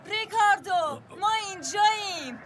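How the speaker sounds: loud, high hail from afar; cheerful shout across the station; calling out. Acted. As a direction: wave them down by voice.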